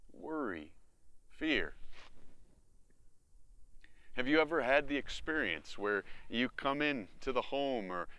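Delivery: normal talking voice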